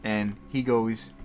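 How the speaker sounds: ordinary delivery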